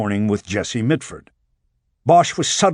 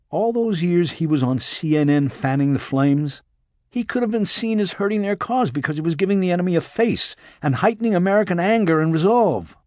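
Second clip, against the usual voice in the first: insistent higher-pitched voice; explaining agent; 7 semitones higher than usual. A narrator's reading. Agent explains the payback theory to the detective, insistent. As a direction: press the theory home, pitched up. Rachel, figure in the story.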